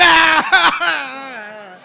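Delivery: loud, high scream of fright; sudden full-voiced shriek; re-enacted scream. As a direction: scream like a terrified kid. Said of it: "and I went".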